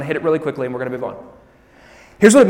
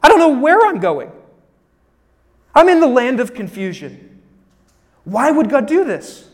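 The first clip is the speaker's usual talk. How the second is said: panicky lost believer; 6 semitones higher, 7 dB louder than usual. Retold or imitated. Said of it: "You're like".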